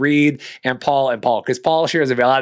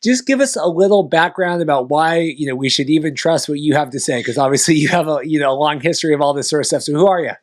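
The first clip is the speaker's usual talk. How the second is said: bright, louder podcast host intro; 4 semitones higher, 4 dB louder than usual. Real, not acted. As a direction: welcome the guest with energy.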